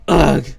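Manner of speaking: low imitated grunt of disgust. English man annoyed by loud music, mock grunt. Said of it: "And he grunted".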